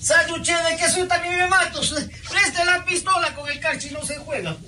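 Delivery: put-on high, sing-song Pasto accent; pastuso accent. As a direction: play up a high Pasto twang. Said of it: "El pastuso abre". (acento pastuso agudo y cantado)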